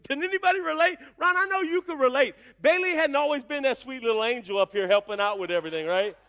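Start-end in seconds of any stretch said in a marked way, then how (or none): none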